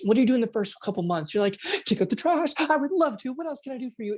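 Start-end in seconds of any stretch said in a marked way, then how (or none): 1.8-4.2 high, bright-eyed eager partner; overjoyed to take out the trash; over-keen new lover offering to help; eager excitement. Retold or imitated. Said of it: "you are literally excited".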